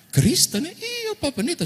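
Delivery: high amazed squeal. Retold or imitated. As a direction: pitch jumps way up in delighted disbelief.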